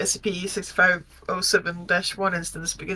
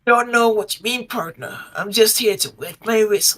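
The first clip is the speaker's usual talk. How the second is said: put-on Texan accent; 2 semitones higher, 5 dB louder than usual. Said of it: "strong Texan accent".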